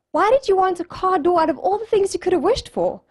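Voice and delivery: high-pitched, incredulous questioning; baffled demand. Retold or imitated. Friends confronting Vanamava over his wish, high and incredulous.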